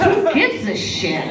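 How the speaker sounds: ordinary talk, nothing marked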